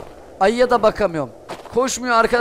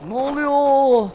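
high, loud alarmed cry then a slow stunned repeat; real startled outcry; startled shout; 5 semitones higher, 7 dB louder, slower than usual. Real, not acted. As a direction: yell in shock at what's happening. Sudden startling event in game.

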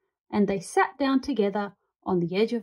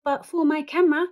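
lightly voiced Japanese accent, raised higher; a gentle hint of a Japanese tourist's accent, pitched up; Japanese lady; 7 semitones higher, 4 dB louder than usual. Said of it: "said a Japanese lady".

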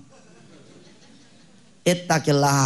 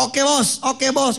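compliant employee; 8 semitones higher, slower than usual. Acted. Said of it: "Bila sebelumnya".

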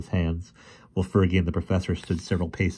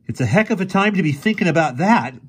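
high, louder grumble; grumbling; 10 semitones higher, 5 dB louder than usual. A narrator's reading. Fergie to the professor on the run, grumbling. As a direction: complain in a raised, high-pitched voice. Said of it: "grumbled Fergie".

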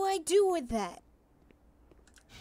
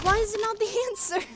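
laughing in disbelief; 6 semitones higher, 6 dB louder than usual. Real, not acted. Puzzle answer fails.

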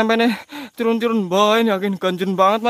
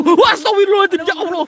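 startled exclamation; 9 semitones higher, 4 dB louder than usual. Real, not acted. Shocked by the pocong.

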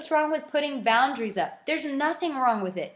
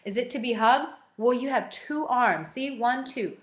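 ordinary talk, nothing marked

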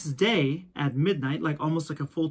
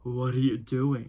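diabolical voice; 2 semitones lower, slower than usual. Retold or imitated. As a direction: echo the boss's menacing call. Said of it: "that diabolical".

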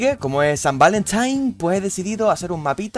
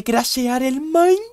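despairing whine; 9 semitones higher, 3 dB louder than usual. Real, not acted. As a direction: wail at the crashed game. Game crashed after three hours.

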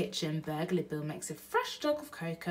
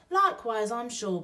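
Burglar Betty; 6 semitones higher, 6 dB louder than usual. Acted. Betty's voice in the story.

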